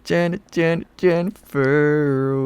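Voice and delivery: high, slow, drawn-out southern accent. Shown as a demonstration. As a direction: stretch Jennifer in a southern accent. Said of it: "I kinda wanna do that now".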